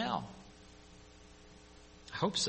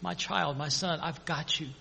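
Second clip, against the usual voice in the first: tender Father; 9 dB quieter than usual. Retold or imitated. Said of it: "to cuddle us".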